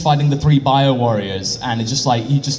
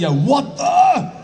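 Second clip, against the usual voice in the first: mock surprise; 11 semitones higher, much slower than usual. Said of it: "As in, like".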